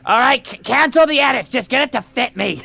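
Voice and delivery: ordinary talk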